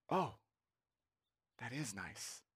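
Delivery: very soft, low, dawning appreciation; quiet realization. Acted. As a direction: let it sink in, almost under your breath. Acted reader quietly takes it in.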